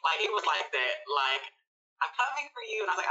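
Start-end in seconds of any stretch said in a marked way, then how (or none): 2.1-2.9 Mister Hyde. Acted. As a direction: play a lightly voiced lurking alter ego. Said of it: "Mister Hyde be like".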